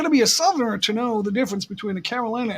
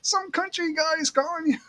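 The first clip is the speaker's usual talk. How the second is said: high-pitched mock call to his wife; lightly put-on relayed announcement; imitating brother-in-law; 10 semitones higher than usual. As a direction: call it out teasingly. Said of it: "And he goes".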